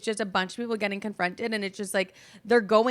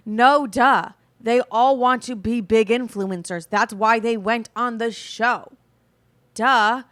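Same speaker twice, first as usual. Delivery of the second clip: exasperated loud rant; 8 dB louder, slower than usual. Real, not acted. Ranting at fans.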